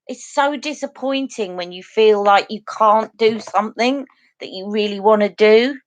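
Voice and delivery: usual speaking voice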